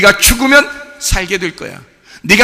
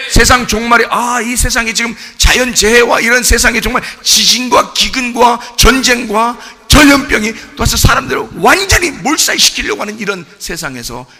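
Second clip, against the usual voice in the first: ordinary delivery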